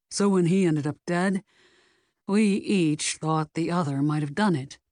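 lightly voiced male character; a hint of a man's voice, owning up; uneasy man explaining. A narrator's reading. Secret lover explaining mutual suspicion to sleuth, uneasy man explaining.

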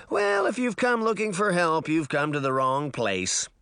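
put-on high, cocky boy crowing; boy boasting to girls seeking help, crowing. A narrator's reading. Crow it high, then let it settle. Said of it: "the boy crowed".

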